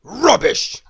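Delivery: loud, high scornful retort. A narrator's reading. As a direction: spit the word out in contempt. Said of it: "retorted Mister Grumpy".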